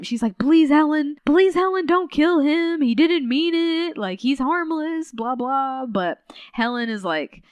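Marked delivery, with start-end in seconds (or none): 0.4-5.8 high, loud, begging imitation of sobbing; hammed-up pleading to spare him; mock crying plea. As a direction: Mona begging Helen through mock tears, high and loud. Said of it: "Mona is just crying this whole time".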